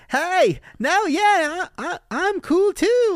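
high, loud mock over-eagerness; desperately eager. Imitating her trying to join a crowd, desperately eager. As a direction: force it high and loud, stretching the end.

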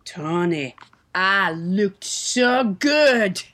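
slow, low self-admiring purr; smug. Acted. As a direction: savor your own looks.

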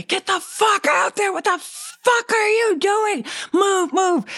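very high, swearing scream; retold screaming. Bill Murray screaming at the new actor, shrieked rage. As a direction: reenact him raging at you, shrieking high. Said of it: "starts screaming at me and swearing at me".

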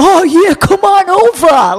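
high, slow mocking party-guy drawl; partying bros. Mocking the party guys calling the ladies over, high and loud. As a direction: ham up a sleazy party invite, high and loud. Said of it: "these guys were like".

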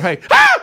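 a casual hey, then a high piercing shriek; startled scream. Retold or imitated. Wife in the laundry room screaming when he appears. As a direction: shriek as if ambushed. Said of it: "stick my head around the corner".

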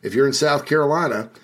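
upbeat sponsor read for a golf coach. Acted. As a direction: sell it like a radio spot. Host reads sponsor ad.

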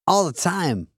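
odd halting pauses, Walken cadence; a friend's mild take on Walken; Christopher Walken impression. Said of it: "[name]'s was good just now. I never heard you do Walken".

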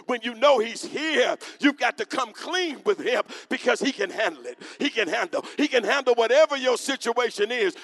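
loud, high, rising preacher build; full-force preaching. Acted. Pastor assuring the church God can handle it, preaching hard. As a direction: build it to a roar.

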